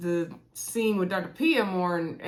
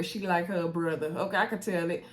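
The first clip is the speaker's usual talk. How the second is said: normal talking voice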